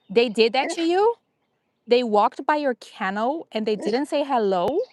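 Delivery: high, rising incredulous questions; mock disbelief. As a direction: play shocked on the dog's behalf. Pretend shock at dog's complaint.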